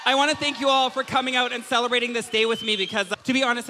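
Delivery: hammed-up show host; MC hype. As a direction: work the crowd like an announcer. Host calling for applause.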